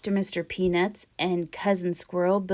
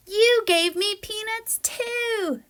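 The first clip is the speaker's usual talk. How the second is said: high, louder squeal of joy; a squirrel thrilled by the gift, squealing; delighted squirrel; 13 semitones higher, 4 dB louder, slower than usual. Acted. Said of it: "said Mister Peanuts".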